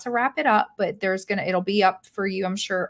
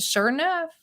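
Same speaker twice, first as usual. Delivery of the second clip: put-on Tennessee accent; 8 semitones higher than usual. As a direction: imitate a Tennessee country accent. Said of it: "she even types Tennessee".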